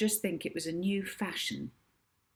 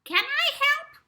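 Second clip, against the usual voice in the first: much higher squeaky woman on an entry phone; squeaky woman's voice; 4 dB louder than usual. A narrator's reading. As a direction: squeak it out like a tiny receptionist. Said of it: "said a squeaky woman's voice down the entry phone".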